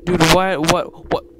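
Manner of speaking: loud, startled stammer; alarmed. Real, not acted. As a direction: blurt it out in real shock. Sudden scare in game.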